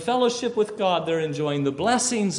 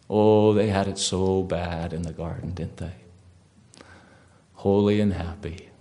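sarcastic mockery; 7 semitones lower than usual. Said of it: "false religions actually honor Eve".

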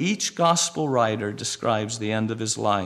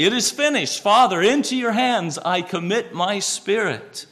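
loud voice; 6 semitones higher, 4 dB louder than usual. Acted. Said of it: "with a loud voice".

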